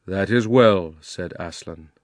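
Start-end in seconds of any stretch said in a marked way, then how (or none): 0.1-1.0 grave, regal lion, louder; solemn lion addressing his new subjects; kingly and resonant; Aslan's voice. A narrator's reading. Aslan to Polly.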